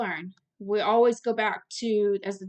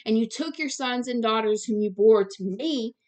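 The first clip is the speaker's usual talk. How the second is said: ordinary delivery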